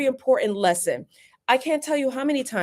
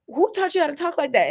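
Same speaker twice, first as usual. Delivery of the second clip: imitating her son; 7 semitones higher, faster than usual. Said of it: "he started asking me questions like".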